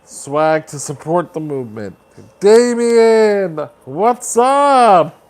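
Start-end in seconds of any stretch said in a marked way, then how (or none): 2.4-5.2 high, loud, stretched-out greeting; excited shout-out. Real, not acted. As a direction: call out to a newly arrived friend. Greeting a viewer who joins.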